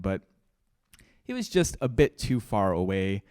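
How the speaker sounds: ordinary delivery, nothing marked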